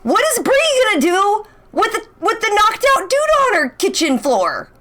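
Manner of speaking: high, bewildered rush of questions; a fan lost in the plot twists; baffled viewer. Retold or imitated. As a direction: pile on the confused questions. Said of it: "and being like".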